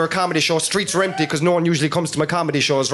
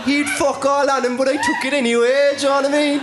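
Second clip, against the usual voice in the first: robber voice; 7 semitones higher than usual. Retold or imitated. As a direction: pitch it up and laugh through it.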